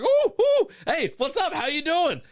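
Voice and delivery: high, giddy hoots into an eager hello; excited mock greeting. Happy tourist retelling how he greeted card handlers, excitedly. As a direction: hail them in a silly high voice. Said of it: "I was like".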